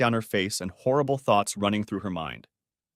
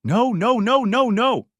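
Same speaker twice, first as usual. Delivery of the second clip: high, loud wail of denial; repeated distraught no; 12 semitones higher, 7 dB louder, slower than usual. A narrator's reading. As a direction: cry out each no, high and loud. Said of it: "she repeated".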